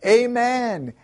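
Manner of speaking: high congregational cry; crowd's shout. A narrator's reading. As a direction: call back high, as a whole crowd would. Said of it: "all the people shouted".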